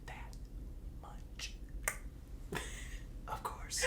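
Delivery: unvoiced whisper; hushed whisper. Real, not acted. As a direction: breathe the word in a whisper.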